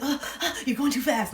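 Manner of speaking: high, startled mock mom voice; imitating anxious mom. Daughter mimicking her mom in the car, high and startled. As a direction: mimic the nervous mother in the passenger seat. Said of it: "and she's like".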